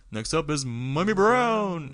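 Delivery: loud, slow stage announcer. Pretend. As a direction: announce him like a club MC. Announcing the next act.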